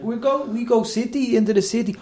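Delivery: high, halting Spanish-student English. Retold or imitated. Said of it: "the Spanish students are like".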